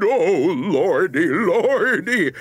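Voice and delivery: loud, quavering wail; hammed-up terrified moan; frightened cry. A narrator's reading. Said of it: "shivered and shook and cried".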